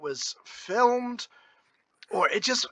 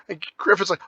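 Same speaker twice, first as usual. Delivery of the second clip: normal talking voice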